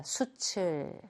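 수칠 with the final ch carried into the second syllable; demonstrated linking pronunciation. Shown as a demonstration. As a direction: say 수칠 softly as su-chil. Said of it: "이렇게 읽으시면 돼요".

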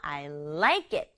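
I like it, like punched and slow; stress on like. Shown as a demonstration. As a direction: hit the word like. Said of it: "좋아한다는 게 중요해요". (like를 강조해서 천천히)

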